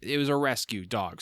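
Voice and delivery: normal talking voice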